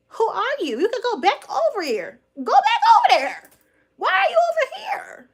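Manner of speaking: mocking a commenter in a high shrill voice; mocking hater voice. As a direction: parody their shrill go back over there. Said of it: "'cause they was like".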